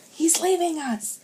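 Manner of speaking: high, loud alarmed cry; panicked cry. A narrator's reading. Boy stranded on ice cries out in panic. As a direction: shout in sudden panic. Boys stranded, officer leaves.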